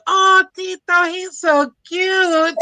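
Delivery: high, slow, swooning coo; cooing women. Retold or imitated. Women admiring a little boy in a stroller. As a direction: gush over a cute toddler. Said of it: "fine women would be like".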